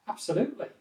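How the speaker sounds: positive agreement, pitched high. Shown as a demonstration. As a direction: agree wholeheartedly with a high lift. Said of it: "that sounds very positive".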